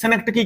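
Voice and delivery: synecdoche said as "senek-t-kee"; variant pronunciation. Shown as a demonstration. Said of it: "कहते हैं".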